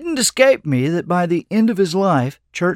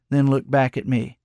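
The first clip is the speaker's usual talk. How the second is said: plain reading voice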